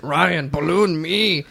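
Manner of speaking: slow, lightly put-on Mexican accent; a hint of a Mexican accent, slow; mock Mexican accent. Host imitating the balloon guy, lightly mock Mexican accent. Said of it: "that's a great, uh, Mexican accent".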